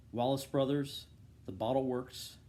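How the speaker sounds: ordinary delivery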